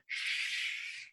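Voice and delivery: unvoiced shush; a hushed shh to the crowd. Shushing students in the background, hushed. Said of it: "you couldn't just scream".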